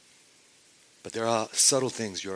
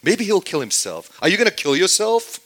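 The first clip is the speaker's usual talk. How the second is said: high, loud mocking jeer; a crowd jeering at Jesus, loud and high; mocking taunt; 8 semitones higher, 7 dB louder than usual. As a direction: taunt him like a sneering crowd. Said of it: "they're taunting him, insulting him".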